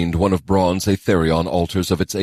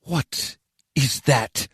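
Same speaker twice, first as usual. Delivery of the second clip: puzzled; 7 semitones higher, much slower than usual. A narrator's reading. Sajay sees strange shapes.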